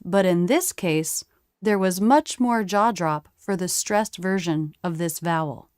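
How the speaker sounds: ordinary delivery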